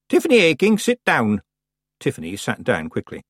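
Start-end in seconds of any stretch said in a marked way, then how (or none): 0.1-1.4 high, firm order to sit; stern old witch. A narrator's reading. Old witch ordering her apprentice to sit down. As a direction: command the girl to sit.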